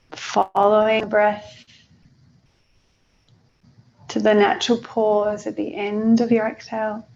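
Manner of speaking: unhurried meditation guide with long pauses; guided meditation. Acted.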